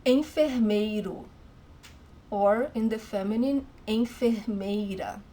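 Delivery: enfermeiro and enfermeira said as in-fer-MAY-ru, in-fer-MAY-ra; Portuguese pronunciation. Shown as a demonstration. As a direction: model both forms clearly.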